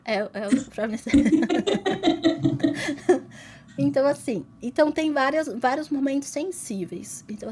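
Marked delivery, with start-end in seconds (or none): 1.0-3.2 laughing. Real, not acted. Laughing at her own spoiler joke.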